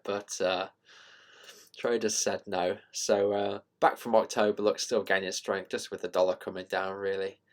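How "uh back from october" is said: normal talking voice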